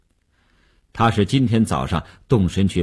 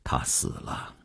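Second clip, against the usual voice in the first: hushed, very soft and grave; slow, deliberate reply; 12 dB quieter than usual. A narrator's reading. Wu answers Mei about the girl, slow and hushed. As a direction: break it slowly, almost under the breath. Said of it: "缓缓地说道".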